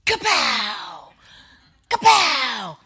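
loud shouted mock gunshot; loud kapow gunshot noises; imitated gunshots. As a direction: bark out the shots like a kid playing guns. Husband fires the gun.

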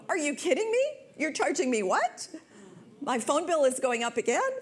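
high, loud mock indignation; voice going up. Customer reacting to the phone bill in the heat of the moment, high-pitched indignation. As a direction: pitch shoots up in incredulous outrage. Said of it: "do you hear my voice even goes up?".

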